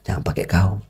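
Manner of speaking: ordinary delivery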